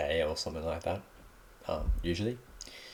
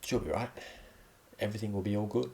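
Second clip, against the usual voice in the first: reassuring example; 4 semitones higher, faster than usual. Shown as a demonstration. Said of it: "as in, like".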